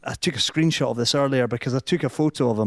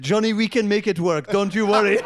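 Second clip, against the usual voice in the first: high, soothing mock French accent; 8 semitones higher than usual. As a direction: reassure him in a hammed-up French accent, pitched up. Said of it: "put on the same French accent that I'm putting on".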